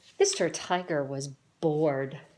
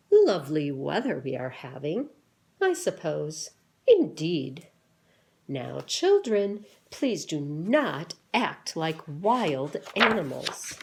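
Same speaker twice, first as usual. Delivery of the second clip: louder, prim and formal animal chatter; stiffly proper talk; 6 dB louder than usual. Acted. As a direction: scold the children like a proper grown-up. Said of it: "And they talk like this".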